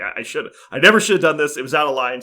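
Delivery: mock apology. Retold daughter apologizes.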